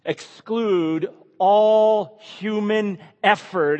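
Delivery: all stretched high and slow; emphatic, slow. Acted. Preacher insisting salvation excludes human effort, emphatic.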